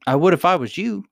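cheeky taunt. A narrator's reading. Captive taunts the royal couple.

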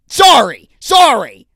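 high, loud, flustered sorry; startled apology. Real, not acted. When his Red Bull spills, a high, loud, flustered sorry. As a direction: blurt it out, flustered.

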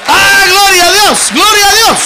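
very high, loud shouted praise; preaching at full force. Real, not acted. Preacher crying out praise to the church, full force. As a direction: belt it to the rafters.

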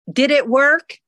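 usual speaking voice

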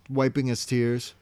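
ordinary talk, nothing marked

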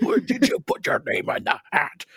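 high, frantic Dumbledore imitation; hammed-up high-pitched interrogation; mock scream. Imitating movie Dumbledore slamming Harry to the wall, mock scream. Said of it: "when Dumbledore screams at Harry".

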